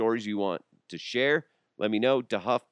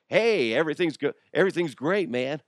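put-on high, louder carefree kid; cheery kid voice; 8 semitones higher, 5 dB louder than usual. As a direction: bounce back cheerfully. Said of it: "they're like".

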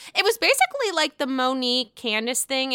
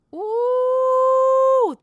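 very high, drawn-out ooh; a long squealing ooh at juicy shade; delighted scandalised ooh; shocked ooh; 14 semitones higher, 6 dB louder than usual. Real, not acted. Reacting to shady comeback.

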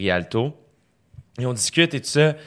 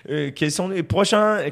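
put-on slow, higher-pitched, hesitant French-from-France accent; awkward French accent; 7 semitones higher, slower than usual. Actor delivering his film line, unsure French accent. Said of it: "maintenant, fais ça dans un film".

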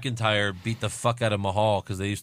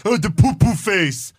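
mocking promo voice; 7 semitones higher, 6 dB louder, slower than usual.

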